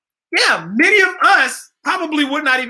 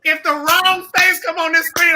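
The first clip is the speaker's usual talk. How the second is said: usual speaking voice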